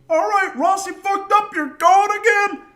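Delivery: high, loud bellow of a furious coach; coach yelling. Retold or imitated. Coach yelling at the team to run again, loud and high. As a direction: bark it across the field. Coach orders another lap.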